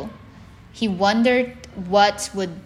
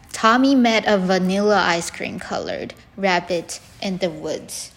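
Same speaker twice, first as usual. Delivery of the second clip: plain reading voice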